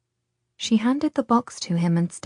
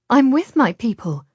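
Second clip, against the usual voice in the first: higher, louder, digging in; stubbornly; 5 semitones higher, 6 dB louder than usual. A narrator's reading. Girl defying Radu's claim, stubborn and loud. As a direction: refuse to give an inch. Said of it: "she said stubbornly".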